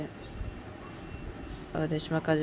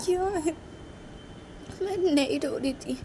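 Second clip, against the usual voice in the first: high, slowed, crying voice; acted whimpering protest; tearful denial; 11 semitones higher than usual. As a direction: deny it as if close to tears. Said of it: "तुम फिर से रो रही थी".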